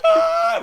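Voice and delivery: very high mock child's shriek; hammed-up piercing wail; imitated yell. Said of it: "I'm yelling at the".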